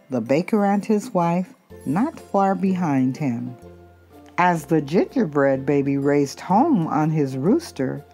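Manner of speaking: ordinary delivery, nothing marked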